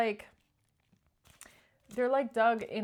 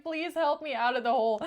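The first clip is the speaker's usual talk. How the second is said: higher, louder hammed-up begging; desperate mock plea; 5 semitones higher, 7 dB louder than usual. False person in a hole begging Queen of Wands, hammed-up desperate plea. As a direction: beg like someone stuck in a hole. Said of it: "Because they're like".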